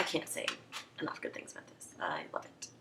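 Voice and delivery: soft whispered gush. Real, not acted. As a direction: whisper it like a secret. Whispered praise.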